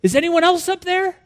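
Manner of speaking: high, unforced mock call; light, high-pitched echo of the cliffhanger; calling up for another answer. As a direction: pipe it upward hopefully. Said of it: "look back up and say".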